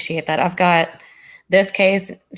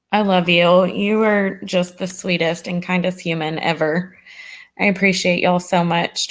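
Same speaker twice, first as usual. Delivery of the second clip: ordinary delivery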